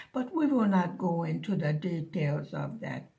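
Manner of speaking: normal talking voice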